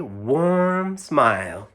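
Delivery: plain reading voice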